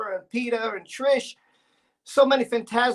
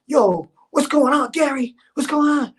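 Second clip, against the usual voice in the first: higher, louder hyped-up greeting; hyper Rob; 3 semitones higher, 7 dB louder than usual. Retold or imitated. Gary imitates Rob answering the phone, hyped and high. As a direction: burst in all hyper and high. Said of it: "He was like".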